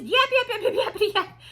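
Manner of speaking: high, punched burst of yaps; relentless yapping imitation. Talking over Princess Diaries, relentless mock yapping. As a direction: mimic a chatterbox with loud, high yapping. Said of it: "She was just relentless".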